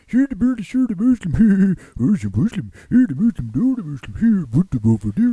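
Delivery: high, loud cartoonish frenzy; frantic babbling stranger. Stranger raving at the interviewer about Muslims, frantic high babble. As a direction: gabble it as a hysterical little madman.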